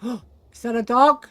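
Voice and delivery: gasp, then a slow, loud rising question; suddenly startled, loud and slow; surprised gasp. Real, not acted. Hears a dog, gasps.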